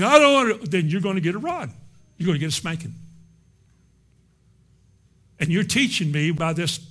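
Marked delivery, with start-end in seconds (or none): 0.0-0.7 high, loud mock child refusing; mock defiant child. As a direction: play the pouting kid. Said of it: "you're acting like".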